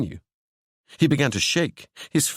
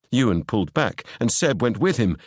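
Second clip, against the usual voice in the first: ordinary delivery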